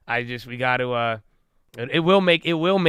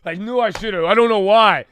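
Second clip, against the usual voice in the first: higher, whining self-blame; regretful whine; 7 semitones higher, 5 dB louder than usual. Retold or imitated. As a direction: moan about the missed chance. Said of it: "You know, like".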